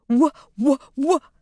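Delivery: high, broken stuttering; stunned stutter. A narrator's reading. Shocked mother can only stutter into the phone. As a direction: choke on the word, shocked. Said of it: "gibberish was the only thing coming out".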